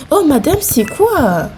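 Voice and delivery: taken aback by another player; surprised. Real, not acted. Gamer baffled by another player, surprised.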